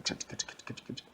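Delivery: quiet, rapid voiceless "ch" clicks; repeated soft mouth-made smacks; imitated slapping noise. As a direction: tick out a menacing "ch-ch-ch". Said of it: "he was getting madder, just like".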